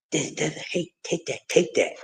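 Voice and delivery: very soft, low monotone mumble; barely voiced, soft-spoken boxer impression; Devin Haney. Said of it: "in the Devin Haney voice".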